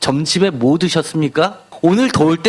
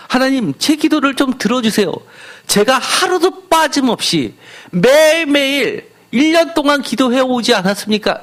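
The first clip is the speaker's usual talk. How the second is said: boastful prayer; 7 semitones higher than usual. Retold or imitated. A self-satisfied believer pressing God to answer, mock pleading. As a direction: mimic a smug believer reminding God of his record.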